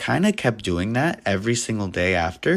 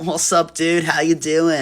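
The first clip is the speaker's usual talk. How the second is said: higher, relaxed dude voice; breezy and carefree, like a chill guy; mock surfer dude; 7 semitones higher, 3 dB louder than usual. [name] greeting his nervous manager, higher laid-back dude voice.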